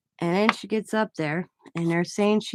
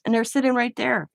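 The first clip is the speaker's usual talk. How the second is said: ordinary delivery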